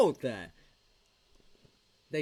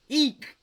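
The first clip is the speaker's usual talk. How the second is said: high squeaky hiccup eek, louder; hiccup sound; 7 semitones higher, 7 dB louder than usual. Shown as a demonstration. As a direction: yelp a hiccup like a Russian. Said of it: "you know what the sound is in Russian?".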